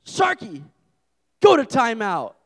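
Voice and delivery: put-on loud, high child's command; scolding toddler. Son sending his pet shark to timeout, loud high scolding. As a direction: imitate a small boy laying down the law loudly. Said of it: "And he's like".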